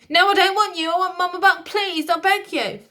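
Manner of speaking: loud, high tearful begging; beg, distraught; upset child. Acted.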